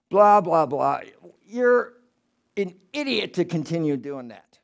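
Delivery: a sneering voice looking down on a fool; mocking spirit voice. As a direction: scoff at the idiot as if from above.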